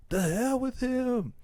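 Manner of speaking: high, drawn-out yell kept down in volume; held-back mock yell. Retelling the son's outburst at absent dad, held-back yell. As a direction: imitate a yell without the full volume. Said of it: "and he yells at him".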